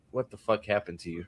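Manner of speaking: low, hushed mock disappointment; upset father. Imitating the idiot twins' father, quiet dismay. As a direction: mutter it like a dismayed dad, very soft. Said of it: "Tweedledee and Tweedledum's upset father going".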